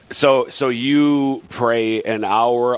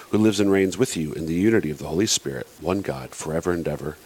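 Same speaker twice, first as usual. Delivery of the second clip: prayer reading; 3 semitones lower than usual. Acted. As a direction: read it as a formal prayer. Reads the collect.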